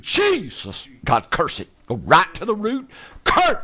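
shouted high rebuke; laying a curse. Real, not acted. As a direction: rebuke the sickness at full force. Healer commanding curse on illness.

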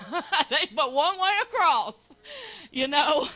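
loud laughing exclamation. Real, not acted. Preacher laughing loudly to the congregation at her own point. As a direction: laugh it out big and slow.

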